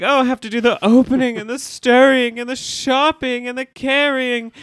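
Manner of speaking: lightly hammed-up Cordelia whine; imitating Cordelia. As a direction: play it as a spoiled, put-upon rich girl. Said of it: "she's like".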